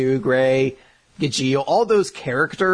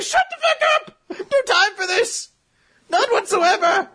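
high, strained comic screaming; hammed-up shout to cut someone off; mock angry yell. Makarov silencing Mavis's protest, hammed-up furious yell.

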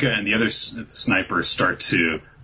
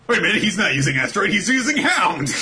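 rushed, fast and higher, sudden realization; excited realization; 12 semitones higher, faster than usual. Retold or imitated. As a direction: blurt it out fast as it clicks. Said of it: "And like".

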